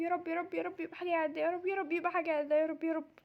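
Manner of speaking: soft, slightly low repeated prayer; muttered pleading. Acted. Pharmacist hopes for an ordinary request, soft muttered prayer. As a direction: murmur it quickly to oneself.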